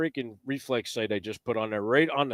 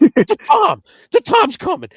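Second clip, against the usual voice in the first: excited warning; 11 dB louder than usual. Retold or imitated. Frank's urgent hunting whisper retold.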